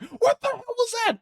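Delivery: high-pitched, incredulous squawk; acted shock, sky-high pitch; baffled outburst. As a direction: voice shoots way up in disbelief. Party reacts to the vanished devil.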